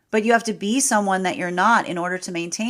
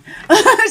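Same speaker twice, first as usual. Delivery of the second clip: sudden high laugh, louder; high burst of laughter; 12 dB louder than usual. Real, not acted. Reader laughs as a second card falls, squeaky laugh. Reacts to extra card drawn.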